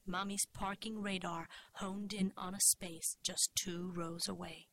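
usual speaking voice

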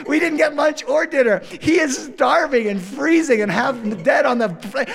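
ordinary delivery, nothing marked

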